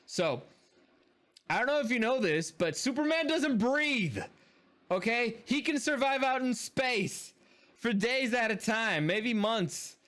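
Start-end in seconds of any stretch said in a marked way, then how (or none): 1.5-10.0 high-pitched, incredulous insistence; exasperated rant. Real, not acted. Film reviewer objecting to a Superman plot hole, exasperated. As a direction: hammer the point home in a raised pitch.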